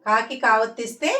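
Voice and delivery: ordinary talk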